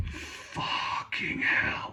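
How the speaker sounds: a slow, hushed curse under the breath; whispered disbelief; exasperated whisper. Retold or imitated. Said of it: "And I'm like".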